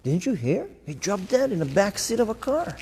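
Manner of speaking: a hint of gossiping friends; mock friends at a gathering, tossing it off. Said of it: "My friends are gonna meet".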